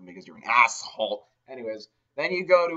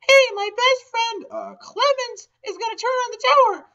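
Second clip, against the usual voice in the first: put-on high girly friend; 9 dB louder than usual. Shauna telling the player about the tower, high and chirpy. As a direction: chirp it like an eager best friend.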